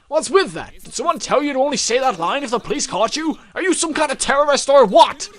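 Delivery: loud, high, angry cop interrogating; young detective grilling a suspect, loud and angry; gruff detective. Acted. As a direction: bark questions at the suspect. Detective voice again.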